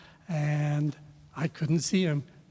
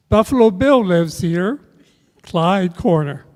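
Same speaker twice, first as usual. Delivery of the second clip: put-on excited kid call; 2 semitones higher, 10 dB louder than usual. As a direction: call it out proudly like a kid passing the house. Kid calling out from back seat.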